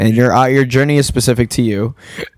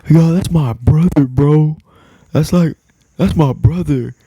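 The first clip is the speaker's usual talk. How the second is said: higher, lightly voiced hype kid; imitating hype kid; 6 semitones higher, 3 dB louder, slower than usual. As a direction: imitate an excited fan bigging up his friend. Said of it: "He's like".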